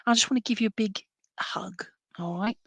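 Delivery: a soft whispered hug for a viewer; breathy tender whisper. Real, not acted. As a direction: whisper it gently.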